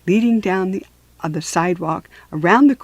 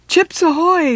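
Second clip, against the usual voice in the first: high, loud call over the radio; little brother calling the code again, high and loud; 9 semitones higher, 5 dB louder than usual. Acted. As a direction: call it out to the tent. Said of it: "Stink called again".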